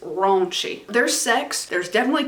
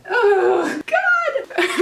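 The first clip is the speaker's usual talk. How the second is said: high, drawn-out melodramatic wail; hammed-up lament, pitched way up; mock grief wail; 11 semitones higher, 4 dB louder than usual. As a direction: wail it in exaggerated despair. Mock wail over TikTok ban.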